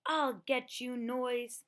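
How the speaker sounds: loud, fed-up threat; angry threat. Acted. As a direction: growl it at the unseen pest. Owl angrily threatens noise.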